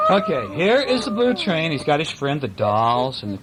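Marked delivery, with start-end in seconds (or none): none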